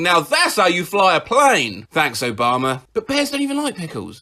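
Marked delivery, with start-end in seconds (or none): none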